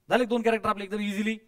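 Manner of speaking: ordinary talk, nothing marked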